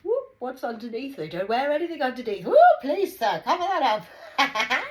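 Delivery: mock scandalised. Teasing about what Scots wear under kilts, squealing. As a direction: shriek like a flustered aunt.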